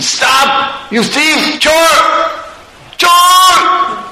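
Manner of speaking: high-pitched yells after a thief; enraged shout. Acted. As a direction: holler it high, building to the last word. Indra chases the thief shouting.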